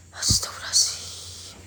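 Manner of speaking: breathy frightened whisper. Real, not acted. Whispered prayer in fear.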